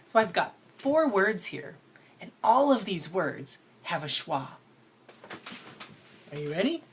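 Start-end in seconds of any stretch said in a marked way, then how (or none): none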